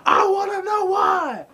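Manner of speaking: slow, very high furious yell; angry shout. Retold or imitated. Rocky yelling at the trainer, slow high furious shout. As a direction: bellow it out, drawn out.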